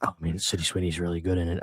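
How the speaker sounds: lightly voiced low hushed friend; hint of the friend dodging the question; Cody impression. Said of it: "impression continues".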